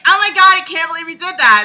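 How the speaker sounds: high, loud gasping "Oh my god"; a girly mock outcry; mock shocked Anastasia. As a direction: squeal it like a shocked heroine. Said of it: "she's like".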